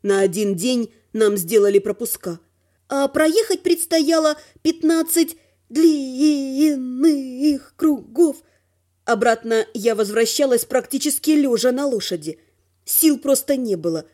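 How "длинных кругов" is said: "длинных" stretched out very long; "длинных кругов" pulled out syllable by syllable; drawn-out emphasis. A narrator's reading. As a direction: drag out the vowels of "длинных" wearily. Stretches word for tedious distance.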